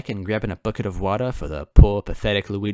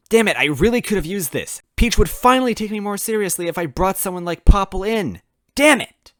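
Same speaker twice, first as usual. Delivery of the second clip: higher, louder exasperation; frustrated; 10 semitones higher, 6 dB louder than usual. Acted. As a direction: vent the frustration. Narrator frustrated.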